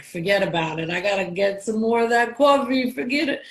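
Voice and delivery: put-on thick New York accent, coffee as "cawfee"; playful New York accent. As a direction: ham up a New Yorker around old friends. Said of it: "and I'm like".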